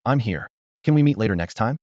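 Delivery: ordinary delivery, nothing marked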